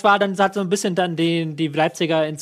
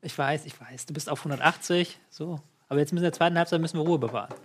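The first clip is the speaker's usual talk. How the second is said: soft mock pep talk, calming; beruhigend; 6 dB quieter than usual. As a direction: talk an overexcited colleague down, softly. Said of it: "Komm mal ein bisschen runter". (leise, gespielt beschwichtigend)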